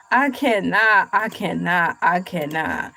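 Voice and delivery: hammed-up I cannot, dying down; mock despair fading. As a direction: let the repeated refusal sink away into a hammed-up sigh.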